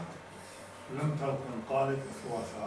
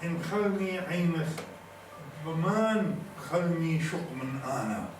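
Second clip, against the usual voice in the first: measured poetic delivery with drawn-out words; poem recitation; 4 semitones higher, slower than usual. Acted. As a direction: declaim each line slowly, pausing between phrases.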